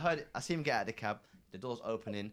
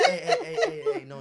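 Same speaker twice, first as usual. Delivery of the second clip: much higher, louder hey hey; mock calming friend; 10 dB louder than usual. Friend hushing the jealous guy in the cab, high mock hush. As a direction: imitate the friend hushing him.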